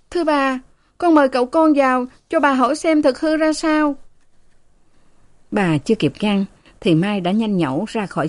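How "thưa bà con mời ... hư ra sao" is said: put-on high, brisk young maid; eager maid. A narrator's reading. Eager maid offers to fetch the young master, high and brisk. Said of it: "Mai nói".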